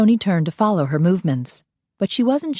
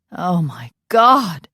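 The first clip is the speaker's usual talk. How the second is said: starstruck gasp; 4 dB louder, much slower than usual. Acted. Brunette bursts from stall, starstruck.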